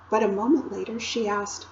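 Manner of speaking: ordinary delivery, nothing marked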